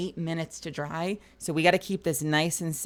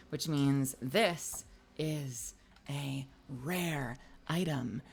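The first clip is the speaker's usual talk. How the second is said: hushed whisper; 3 semitones lower, 5 dB quieter, much slower than usual. Real, not acted. Building hype about a rare print.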